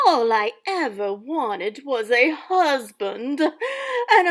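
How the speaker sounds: loud, lively storybook narration; dramatic story reading. Acted. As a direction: read it aloud like a theatrical stepmother. Teacher reads stepmother's story aloud.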